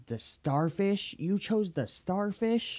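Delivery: asking his friend in disbelief, slow and rising; incredulous. Real, not acted. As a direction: drag it out in disbelief. Mocking disbelief at choice.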